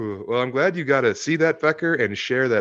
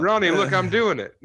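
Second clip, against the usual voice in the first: higher mock excited call; excited wife; 7 semitones higher than usual. Wife calling to Ronnie from the seats, higher excited.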